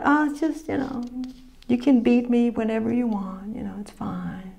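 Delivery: whispered, timid mock voice; mock meek whisper. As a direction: whisper it like a pushover giving up. Mocking meek Christians.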